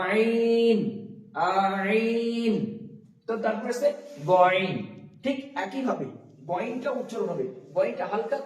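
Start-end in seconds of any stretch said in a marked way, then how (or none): 0.0-2.5 আইন drawn out long from the middle of the throat; throat letter demo. Shown as a demonstration. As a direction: hold আইন slowly, squeezed from the throat. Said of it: "বলার চেষ্টা করুন আমার সঙ্গে সঙ্গে".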